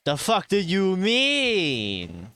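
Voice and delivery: ordinary talk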